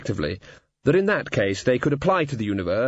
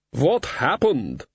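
bewildered warrior; much slower than usual. Acted. Dead warrior Wolf, bewildered.

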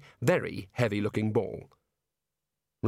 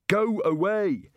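go away; 10 semitones higher, 5 dB louder, much slower than usual. A narrator's reading. Rincewind dismisses the warder.

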